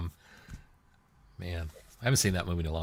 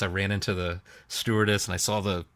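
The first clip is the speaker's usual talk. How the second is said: ordinary delivery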